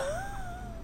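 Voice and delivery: a short high burst of laughter in disbelief; incredulous laugh. Real, not acted. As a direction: laugh at how over the top it sounds. Disbelief at her sentimental post.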